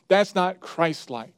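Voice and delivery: ordinary talk, nothing marked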